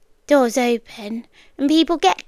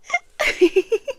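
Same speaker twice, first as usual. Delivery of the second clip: bright falling chuckle, higher; a quick rueful laugh; short high laugh; 9 semitones higher than usual. Acted. Captor laughing at the irony of the tea, high chuckle.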